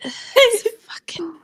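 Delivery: breathless whisper through laughter; whispered laughing. Real, not acted. Player cracking up at the cow joke, whispering. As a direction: whisper it, barely holding in laughter.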